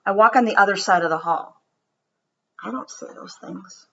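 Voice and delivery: put-on gentle read-aloud storytelling for children; story reading. Teacher reading a story to her students, storybook voice. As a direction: read it to the class like a picture book.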